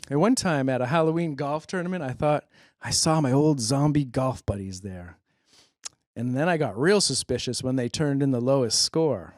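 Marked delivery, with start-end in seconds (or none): none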